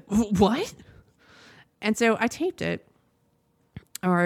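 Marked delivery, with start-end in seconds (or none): 0.1-0.7 stammered, mildly stunned "what"; questioning rise, lightly acted disbelief; retold bafflement. Telling how she reacted to the TV, baffled. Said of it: "I just was like".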